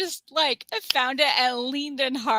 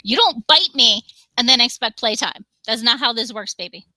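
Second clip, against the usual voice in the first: scolding the pet; 9 dB louder than usual. Real, not acted. Scolding the biting cat.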